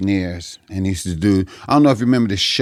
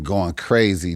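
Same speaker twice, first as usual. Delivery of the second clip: ordinary talk, nothing marked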